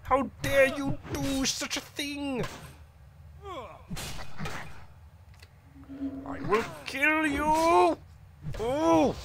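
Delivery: high, slow, drawn-out furious cry; outraged threat. Acted. Paladin threatens the kobold that attacked him. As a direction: spit out the threat with wounded pride.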